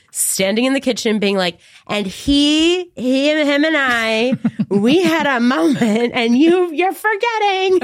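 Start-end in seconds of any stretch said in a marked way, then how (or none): 1.9-7.8 high, drawn-out, insistent reenactment; worked-up drunken rant. Retold or imitated. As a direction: act out a tipsy, wailing protest, stretching the words. Acting out drunken kitchen rant.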